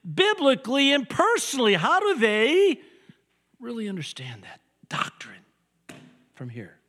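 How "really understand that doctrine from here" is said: dropped to a whisper; hushed aside. Real, not acted. Preacher whispering to the congregation. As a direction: lean in and whisper it.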